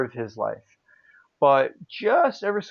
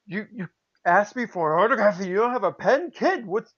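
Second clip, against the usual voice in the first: high-pitched retold exasperation; mock incredulous scolding; 7 semitones higher than usual. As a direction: act out a star chiding a kid. Acts out legend scolding the kid.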